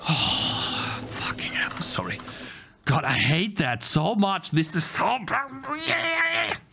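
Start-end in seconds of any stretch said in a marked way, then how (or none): 0.1-2.2 exhausted whispered curse and apology; drained whisper after a meltdown. Real, not acted. After rage, muttering. 4.5-6.6 high, slow anguished wail; pained gamer wail. Real, not acted. Continued rage.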